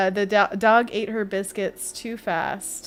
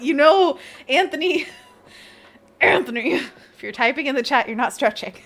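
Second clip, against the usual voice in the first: playful scolding; 5 semitones higher, 7 dB louder than usual. Real, not acted. Teasing Anthony in chat.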